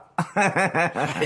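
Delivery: short run of fawning chuckles; obsequious chuckle. Acted. Underling humouring his boss, obsequious chuckle. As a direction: laugh along to please the boss.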